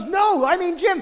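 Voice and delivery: high, insistent no, I mean Jim; insistent correction. Retold or imitated. Woman at the party insisting, pitched high. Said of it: "she goes".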